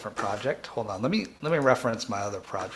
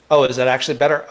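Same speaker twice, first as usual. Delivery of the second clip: loud, delighted surprise; surprised; 4 semitones higher, 15 dB louder, much faster than usual. Real, not acted. Streamer reacting to chat that the audio works, loud surprise. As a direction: burst out, pleased the sound is fixed. Checking viewer audio feedback.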